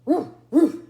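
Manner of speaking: loud roof roof barks; Muffin McClay barking. Acted. Dog barks loudly up at the cat. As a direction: bark it like a big shaggy dog. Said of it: "said Muffin McClay".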